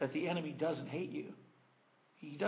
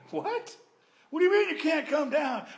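high, loud shocked shout; mock outrage; 9 semitones higher, 6 dB louder than usual. Enemies shouting back at Nehemiah, outraged disbelief.

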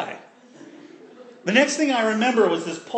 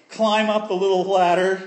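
usual speaking voice

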